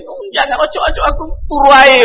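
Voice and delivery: lightly hammed-up refusal, wanting to sleep; lazy excuse. Listener imagined dodging the duel, mock lazy whine. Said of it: "Ya kalau antum paling".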